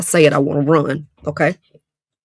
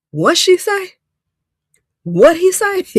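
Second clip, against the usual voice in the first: much higher, puzzled mock reaction; stunned listeners; 13 semitones higher, 4 dB louder, slower than usual. Gossips left baffled by the answer, high and puzzled. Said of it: "acting stunned listeners".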